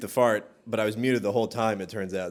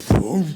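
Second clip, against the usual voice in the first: loud, higher explosive boom; imitated cannonball boom; 6 semitones higher, 7 dB louder than usual. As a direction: burst it out like a cannon. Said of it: "It was like".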